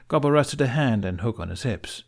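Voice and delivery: ordinary delivery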